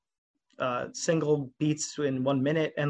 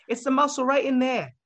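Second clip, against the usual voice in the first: higher-pitched Boston accent impression; 8 semitones higher, 4 dB louder than usual. Fan retelling a drummer's explanation, imitated Boston accent. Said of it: "his, like, little Boston accent".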